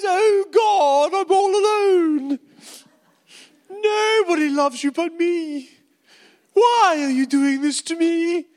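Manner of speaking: high, drawn-out mock wail; sookie whining. Elijah moaning to God alone under a tree, whiny sulk. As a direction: wallow loudly in hammed-up self-pity. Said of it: "he has a sookie lala".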